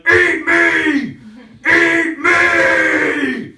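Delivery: high, loud shout; God shouting. Retold or imitated. God yelling at Catholics, a high loud shout. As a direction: bellow it like a crazed deity.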